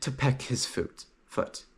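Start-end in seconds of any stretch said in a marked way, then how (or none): none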